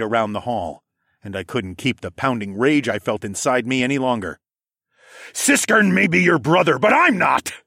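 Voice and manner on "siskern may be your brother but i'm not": loud, higher, furious retort; growled. A narrator's reading. The narrator cutting off Senna's cackle, in a furious growl. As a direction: cut through his cackling with rage. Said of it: "I growled".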